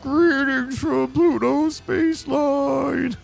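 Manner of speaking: very high, slow, shouted greeting; enthusiastic greeting. Acted. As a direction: belt it out like a showman. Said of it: "He's so enthusiastic".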